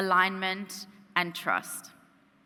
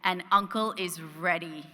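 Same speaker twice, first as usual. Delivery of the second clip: ordinary talk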